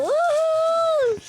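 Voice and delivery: high, loud woo-hoo; cheering whoop. Real, not acted. Player cheering a successful jump, high whoop. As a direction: whoop with joy.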